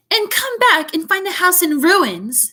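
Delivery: snarled. A narrator's reading. Aunt snarling about Harry wrecking the house. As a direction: spit it out as a threat. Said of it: "she snarled".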